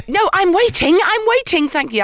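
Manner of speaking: mock indignant queuer; pointed impatience. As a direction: make them wait no longer.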